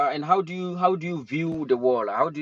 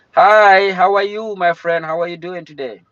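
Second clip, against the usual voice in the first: loud, high, cheerful hello; excited greeting; 7 semitones higher, 11 dB louder than usual. Real, not acted. Greeting a new viewer.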